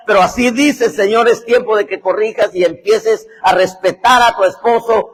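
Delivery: loud, high scolding prophecy; thundering mock prophecy; prophet's rebuke. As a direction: scold her like a fiery prophet. Prophet rebukes the sister loudly.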